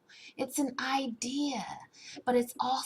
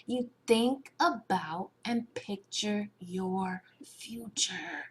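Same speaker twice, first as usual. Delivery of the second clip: slow, deliberate emphasis; 3 semitones lower, slower than usual. Shown as a demonstration. Coach explaining how to visualize, slow and deliberate. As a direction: spell it out very slowly, letting each word land.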